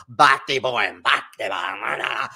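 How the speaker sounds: low, drawn-out fake Jamaican accent; mock him with a slow, exaggerated Jamaican accent. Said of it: "that fake ass Jamaican accent".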